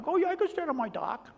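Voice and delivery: put-on high, quick old-lady voice; high-pitched mock older woman; imitated older lady. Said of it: "with this older lady".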